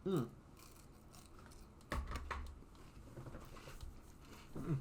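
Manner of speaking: chewing a snack; crunching chips. Real, not acted. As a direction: mouth full, crunching. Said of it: "hear my crunching on the microphone".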